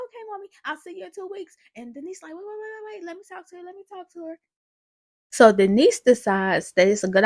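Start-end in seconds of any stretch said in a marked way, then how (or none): 0.1-1.6 put-on high, quick little-girl voice; Olivia. Little girl says goodbye to her mommy, childlike. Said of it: "Then she said". 2.3-4.4 high-pitched, hurried plea; urgent interruption. Retold or imitated. Denise stops the child hanging up, urgent.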